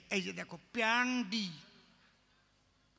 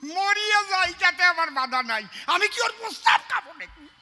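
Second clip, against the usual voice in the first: indignant refusal; 7 semitones higher, 9 dB louder than usual. Retold or imitated. Villager refuses the doctor's medicine.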